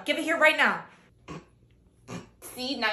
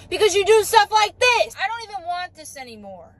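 ordinary talk, nothing marked

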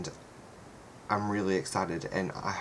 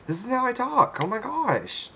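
high, loud, indignant squeal; loud high-pitched defensive outburst; exasperated protest; 7 dB louder than usual. Real, not acted. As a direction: voice shoots way up in exasperation. Indignantly defending his natural voice.

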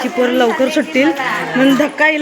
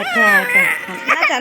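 high cooing; 13 semitones higher, much slower than usual. Real, not acted. Cooing to baby.

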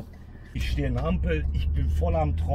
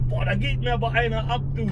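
impressed hitchhiker; 8 semitones higher than usual. Retold or imitated. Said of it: "der meinte".

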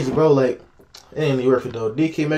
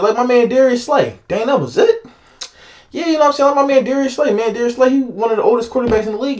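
higher-pitched heartfelt plea; emotional pleading; 6 semitones higher than usual. Real, not acted. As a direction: plead with him to let it go. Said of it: "I be getting emotional when I talk about".

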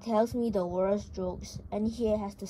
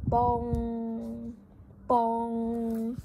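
bong sound. Acted. Clock chime sound effect.

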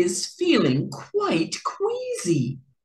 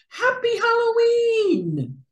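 cheery shout. Acted. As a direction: shout it out to the kids. Cheerful Halloween greeting called out.

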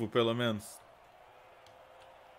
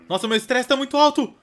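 high, loud frantic whining; a frazzled dad losing it over the phone and beer; stressed-out panic; 10 semitones higher, 7 dB louder than usual. Pretend. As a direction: spiral into a stress meltdown. Stress meter rising, panicking.

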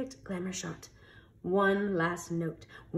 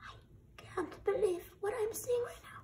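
amazed whisper; 12 semitones higher, 9 dB quieter than usual. Acted. Beasts watch in amazement.

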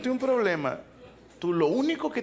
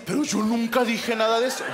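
mock protesting man; 5 semitones higher, 3 dB louder, faster than usual. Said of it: "tú vas a decir".

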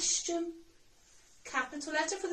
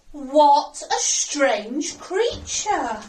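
slow, loud exclamation; exclaiming; 9 dB louder, much slower than usual. Acted. Teacher voicing a jellyfish's exclamation, exclaiming. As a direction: wonder aloud, drawing out each word loudly.

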